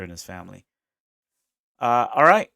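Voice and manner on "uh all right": higher, loud announcing shout; loud hype. Real, not acted. Moving his viewers on to the next clip, loud. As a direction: shout the move to the next one.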